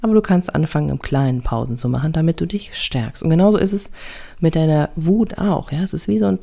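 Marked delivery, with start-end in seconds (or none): none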